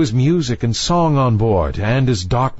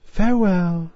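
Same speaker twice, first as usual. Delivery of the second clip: put-on young mermaid voice; 9 semitones higher than usual. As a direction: call it lightly like a young girl. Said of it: "so she said".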